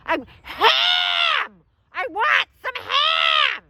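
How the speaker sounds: high, drawn-out holler; comically frantic bellowing; exasperated shout. Retold or imitated. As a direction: yell slowly for someone who can't hear. Shouting order through a mask.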